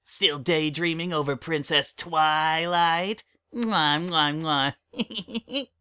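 put-on high kid voice smacking kisses; mock smooching like a cheeky colt; teasing kid voice. Child teases with kissing noises.

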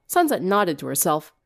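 plain reading voice

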